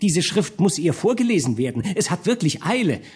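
high, hurried courtier cutting in; hasty chancellor. A narrator's reading. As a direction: rush in with a high, pressing voice. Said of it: "fiel Ambrosius Volland hastig ein".

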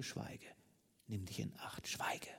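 hushed, barely voiced warning; menacing whisper. A narrator's reading. Lover about to strike his mistress, menacing whisper. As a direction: warn under the breath in short whispered commands.

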